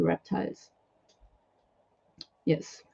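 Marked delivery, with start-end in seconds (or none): none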